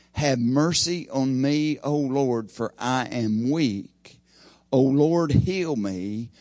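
slow, earnest psalm reading; preacher reading David's cry for mercy, slow and earnest; scripture reading. Acted. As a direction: let each plea hang, slowly. Reads psalm verse two aloud.

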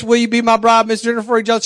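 keyed-up retold proposal; hurried proposal. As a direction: get the question out in an excited rush. Retells hurriedly finishing the question.

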